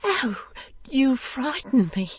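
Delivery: high, slow, frightened wife; timid high female character voice; startled cry. A narrator's reading. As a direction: say it high, still reeling from a fright. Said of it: "cried his poor little wife".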